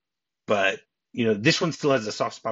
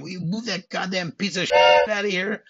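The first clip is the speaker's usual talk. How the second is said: imitated angry cop yell, high-pitched; mock furious shouting; Lorenzo screaming; 8 semitones higher, 4 dB louder than usual. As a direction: act out bellowing at a cabbie to move. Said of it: "Lorenzo starts screaming at him again".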